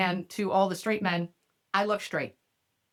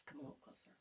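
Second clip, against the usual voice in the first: very soft, teasing come-on; mock seductive whisper; 7 semitones higher, 26 dB quieter, much faster than usual. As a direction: lure him in with a hushed voice.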